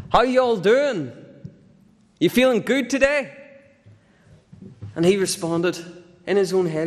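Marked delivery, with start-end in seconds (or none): 0.1-3.4 much higher, bouncy cheerful welcome; cheery host greeting. Retold or imitated. Church host greeting the Sunday crowd, chirpy hyped welcome. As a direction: pump up the crowd like a hype host.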